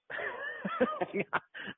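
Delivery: a high burst of laughter breaking up the take; can't keep a straight face, laugh it off; real laugh. Real, not acted. Caller cracking up mid-voicemail, real laugh.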